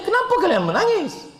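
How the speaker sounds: high-pitched, puzzled question to crying friends; mock bewilderment. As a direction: ask in exaggerated high-voiced disbelief. Acts husband questioning crying friends. (pura-pura hairan dengan suara tinggi)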